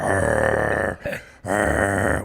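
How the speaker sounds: opposing lineman growls at players, mock growl. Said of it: "started growling".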